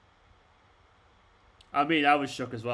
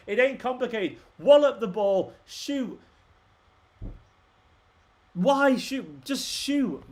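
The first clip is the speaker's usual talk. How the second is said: angry yelling; 6 semitones higher, slower than usual. Real, not acted. Fan yelling at his team on TV, exasperated angry shout. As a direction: hammer home that they must shoot.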